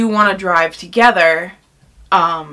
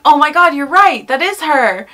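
loud, higher excited exclamation; bursting out excitedly, retold; excited recognition; 6 semitones higher, 5 dB louder than usual. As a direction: imitate a thrilled moment of recognition. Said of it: "they were really excited".

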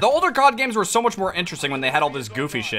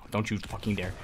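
much softer, low, warning under the breath; tense hush; 10 semitones lower, 12 dB quieter than usual. Real, not acted. Gamer warning an enemy player under his breath, tense hush. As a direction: dare him quietly.

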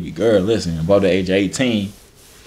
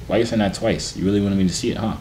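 ordinary talk, nothing marked

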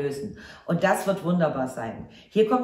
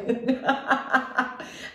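laugh; 6 semitones higher than usual. Real, not acted. Laughs at card reveal.

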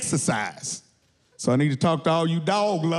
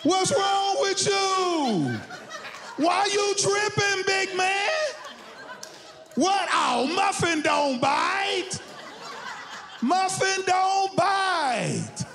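very high, long-stretched holler; indignant dog owner; 12 semitones higher, much slower than usual. Acted. Dog owner scolding man with a gun, high drawn-out outrage. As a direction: drag each word out high in mock outrage.

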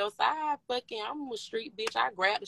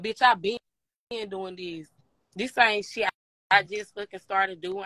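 ordinary talk

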